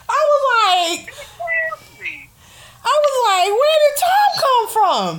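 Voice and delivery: high comic squeal, falling at the end; mock-outraged high-pitched retelling; hammed-up disbelief. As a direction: wail the baffled question for laughs. Repeats own baffled reaction.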